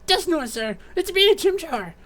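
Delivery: high-pitched, excited little sidekick; eager Chimchar. Acted. Captive appealing to admired Dusknoir, high and eager. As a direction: call out high and hopeful.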